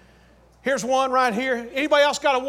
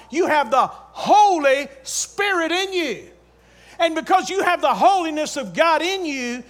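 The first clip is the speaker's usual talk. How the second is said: emphatic, raised sermon delivery; stretched, forceful pulpit phrasing; fervent preaching; 4 semitones higher, 3 dB louder than usual. Real, not acted. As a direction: preach it with weight on each key phrase.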